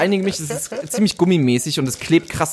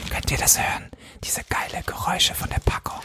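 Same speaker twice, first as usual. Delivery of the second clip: ASMR-style whisper; 5 semitones higher, 6 dB quieter than usual. Acted. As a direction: whisper over the rustling wrapper. Rustling snack packaging for listeners.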